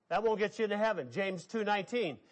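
ordinary talk, nothing marked

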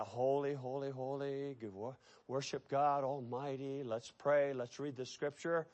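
low, soft, reverent murmur; hushed mock worship. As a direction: imitate a subdued congregation at prayer. Imitates solemn synagogue worship before uproar.

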